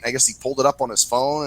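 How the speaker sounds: usual speaking voice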